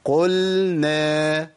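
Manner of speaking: "قلنا" slowly as "qul-na"; drawn-out chant, lam distinct in "قلنا"; clear lam. Shown as a demonstration. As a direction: place the l of "قلنا", then move to n. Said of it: "أقول إيه؟".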